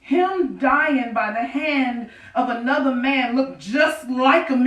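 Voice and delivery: usual speaking voice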